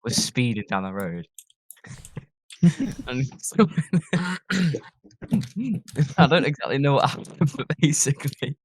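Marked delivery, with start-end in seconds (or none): 2.6-8.6 higher voice, giggling through the words; amused, giggly retelling; laughing while telling. Real, not acted. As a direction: tell it while fighting back laughter.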